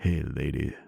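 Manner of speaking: husky come-on voice; low sexy voice. Acted. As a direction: purr it like a smooth flirt. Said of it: "At least it's all low and sexy".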